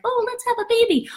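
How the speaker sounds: dreamy excitement. Retold or imitated. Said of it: "you were like".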